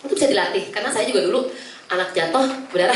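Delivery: ordinary talk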